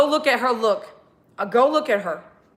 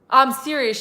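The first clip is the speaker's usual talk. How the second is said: normal talking voice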